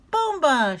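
high, loud game cry; playful shout. Acted. Flip shouting his game word, loud and playful. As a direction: yell it while bouncing. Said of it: "shouted Flop".

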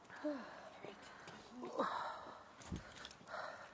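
breathy whispered sigh. Real, not acted. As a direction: let out a nervous whispered sigh. Unvoiced sigh in dark.

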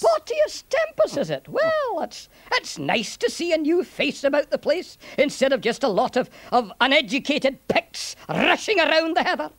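lilting Scots with swooping pitch; chatty Scottish centurion, welcoming. Acted. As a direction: greet the stranger in a Scottish accent.

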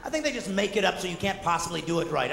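normal talking voice

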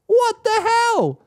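high, slow disbelieving what the hell; genuinely baffled exclamation; surprised. Real, not acted. Reacting to drift optimizer.

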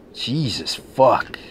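usual speaking voice

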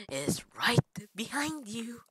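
spooky slow whisper; creepy whisper. Acted. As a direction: whisper it like a lurking stalker. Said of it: "I sound like a creepy dude".

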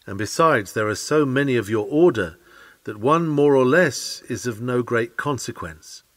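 ordinary talk, nothing marked

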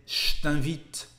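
je t'invite said as ch't'invite; casual French je t'invite. Shown as a demonstration. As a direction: turn je into ch before t'invite. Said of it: "the sound je ... become ch".

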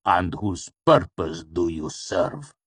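slow, deliberate demand; measured, controlled menace; cold interrogation. A narrator's reading. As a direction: press the question coolly on a helpless servant. Master interrogates tortured servant.

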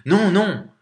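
high, loud alarmed shout; desperate cry of protest. A narrator's reading. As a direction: shout to stop him at once. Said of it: "une voix cria".

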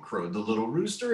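plain reading voice

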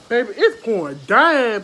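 loud, thrilled call about the pouring rain; shouting excitedly to viewers about the downpour. Real, not acted. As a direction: belt it out over the rain noise.

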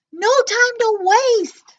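high slow loud command; urgent shout. Acted. Nezlex shouting to the siblings, high and loud. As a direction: hurry them inside.

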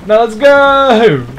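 loud, high, slow yell; big elongated battle cry; drawn-out rallying shout. Real, not acted. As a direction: stretch the call out long and loud. Repeats the rallying call, stretched.

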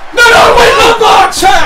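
high, loud alarmed commentary; frantic shouting. Real, not acted. Commentator reacting to a sudden turn, shouting frantically. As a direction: shout urgently as it all happens.

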